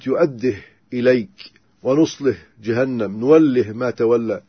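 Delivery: no vowel after the ه in يؤده، ونصله، نوله; "yu'addih, nuslih, nuwallih" for يؤده، ونصله، نوله; silent-vowel ha. Shown as a demonstration. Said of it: "بل يسكن الهاء".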